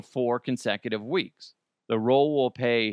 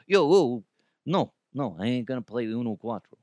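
attempted New York accent. A passerby waves off the Uno pitch in a rough New York accent. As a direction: brush it off fast in a shaky New York accent. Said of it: "I don't know a New York accent".

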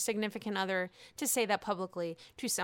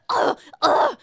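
loud groans; 9 semitones higher, 9 dB louder than usual. Real, not acted. Reacting to Ramona quote.

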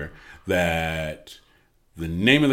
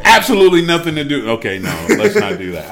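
laughing; 6 semitones higher, 9 dB louder than usual. Real, not acted. Laughing at their own joke.

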